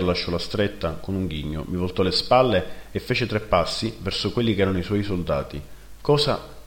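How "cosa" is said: high, startled question; bewildered. Acted. Narrator startled as his brother turns away, bewildered. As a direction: blurt it out, not understanding. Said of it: "feci non capendo".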